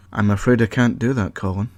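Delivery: plain reading voice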